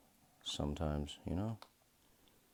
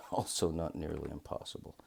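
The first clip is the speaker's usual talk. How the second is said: ordinary delivery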